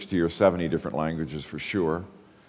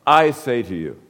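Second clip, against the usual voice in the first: "I" pushed up and louder, the rest falling away; stress on I; 4 semitones higher, 6 dB louder than usual. Shown as a demonstration. Preacher showing the Greek emphasis, lean on "I". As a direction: punch "I" in "I say to you". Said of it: "that's an emphasis. That's personal pronoun".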